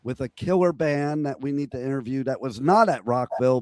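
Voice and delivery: lost voice, hoarse and strained; talking through a shot voice. Real, not acted. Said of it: "I lost my voice".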